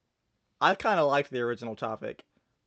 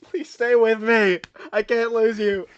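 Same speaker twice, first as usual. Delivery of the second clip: dramatic pleading; 5 semitones higher, 7 dB louder, slower than usual. Acted. Improv pleading.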